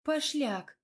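high, mock-outraged retort; chiding exclamation. A narrator's reading. Wife answering husband's innuendo, chiding.

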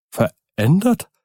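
high, rising echo of disbelief; incredulous cry. A narrator's reading. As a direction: throw the word back as a shocked question. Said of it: "rief Poole". (ungläubiger Ausruf)

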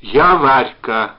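slow, loud, heartfelt announcement; intense, stretched words; heart-stopping voice. A narrator's reading. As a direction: announce yourself with drawn-out feeling. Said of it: "сказала она таким голосом".

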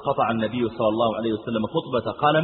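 normal talking voice

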